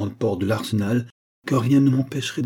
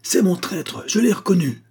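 cri aigu et fort, à pleine voix; crie-le pour arrêter Valerio; criant à tue-tête; 10 semitones higher, 4 dB louder than usual. A narrator's reading. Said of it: "en criant à tue-tête". (le compagnon crie à tue-tête pour barrer le chemin, voix très aiguë)

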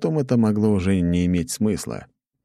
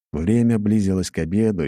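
ordinary delivery, nothing marked

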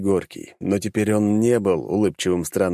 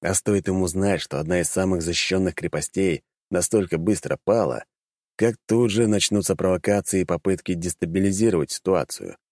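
usual speaking voice

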